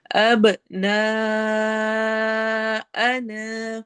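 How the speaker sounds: slow chanted tajweed with a long drawn ha; Quranic recitation. Shown as a demonstration. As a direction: recite it, stretching the vowel. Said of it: "قلقلہ eco sound ہے".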